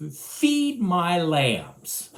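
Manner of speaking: very slow, tender command; slow gentle command. Acted. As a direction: lay each word down slowly. Jesus gives command.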